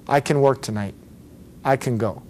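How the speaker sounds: "can" weakened to "kun"; unstressed "can", hardly any vowel; reduced can. Shown as a demonstration. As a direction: let "can" slip by with a schwa. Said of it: "It's gonna be".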